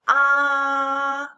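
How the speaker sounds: long, loud "ah" held level; first tone. Shown as a demonstration. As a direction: hold "ah" high and flat. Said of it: "high and flat".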